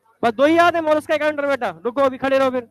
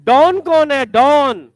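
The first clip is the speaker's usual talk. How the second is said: louder, sharp teacher question; loud demand; 8 dB louder, much slower than usual. Real, not acted. Teacher demands an answer from the class, loud. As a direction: call it out to the class.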